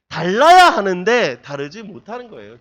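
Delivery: loud, slow and deliberate; preacher pressing the point home, slowly; emphatic preaching. Acted. Preacher urging the congregation, slow forceful emphasis.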